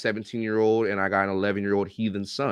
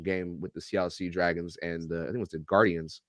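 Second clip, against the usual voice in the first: ordinary talk, nothing marked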